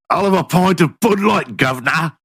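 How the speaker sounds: mock Cockney hooligan ordering a pint; hammed-up Cockney bloke at the bar; lightly put-on Cockney accent. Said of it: "with Cockney accents".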